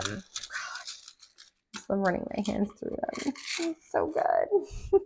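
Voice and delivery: soft, savoring murmur; soft and dreamy, savoring the feel; hushed delight. Real, not acted. Reader stroking new tarot cards, soft and delighted. Touching the new card deck.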